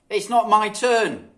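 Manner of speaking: slow, loud sulky protest; a kid refusing a chore; sulky child. A narrator's reading. Children squabble.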